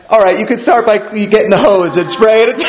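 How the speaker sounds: a boss laughing off the mess, teasing. Retold or imitated. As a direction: retell her joke while still laughing. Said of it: "gut laugh".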